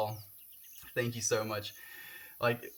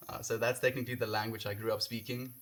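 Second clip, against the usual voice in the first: usual speaking voice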